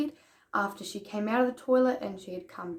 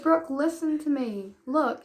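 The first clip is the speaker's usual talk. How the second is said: firm calming; 6 semitones higher, 6 dB louder, slower than usual. Retold or imitated. Calming crying friend in retold scene.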